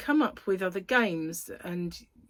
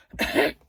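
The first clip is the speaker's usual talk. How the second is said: cough; 7 dB louder than usual. Real, not acted. Coughing mid-vlog.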